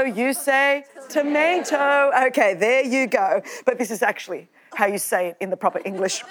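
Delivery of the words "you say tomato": "tomato" with an American ay, to-MAY-to. Shown as a demonstration. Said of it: "I say tomato".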